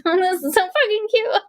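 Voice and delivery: high-pitched gush over something cute. Retold or imitated. Viewer melting over a sweet movie kiss, gushing. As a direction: coo it way up high, smitten.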